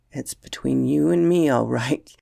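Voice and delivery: a touch of amusement, slowing at the end; lightly laughing. A narrator's reading. Uncle agrees to nephew's secret, mildly laughing.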